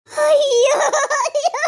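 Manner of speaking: loud, high wailing cry; anguished wail. Acted. Grieving woman to her friend, loud wailing cry. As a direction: wail out in grief.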